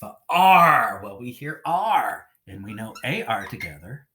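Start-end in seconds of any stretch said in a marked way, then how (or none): 0.0-1.0 "F-, R" with a long, loud AR; "F-, R" high, the ar held out; sound isolated. Shown as a demonstration. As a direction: split off the f, then swell loudly on "R". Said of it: "What do we hear next?".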